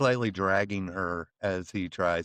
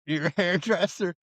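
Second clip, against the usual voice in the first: amused disbelief; 7 semitones higher than usual. Real, not acted. Repeating the line in disbelief.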